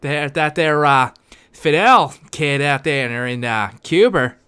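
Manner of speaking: put-on Cuba said Cuber in a Boston accent; a light Boston Kennedy riff; Boston JFK. Riffing on Kennedy's Cuba in a light Boston accent. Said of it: "him say fucking Cuba".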